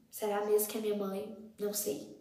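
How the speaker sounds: soft, half-whispered doubt; hushed disbelief. Retold or imitated. Daughter amazed her mother stays calm, hushed disbelief. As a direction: wonder it quietly to yourself.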